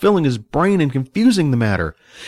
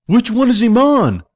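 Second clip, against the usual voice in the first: high, desperate question; urgent; 11 semitones higher, 4 dB louder than usual. A narrator's reading. Celine presses Billy to pick the impostor, urgent. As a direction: ask it urgently with raised pitch.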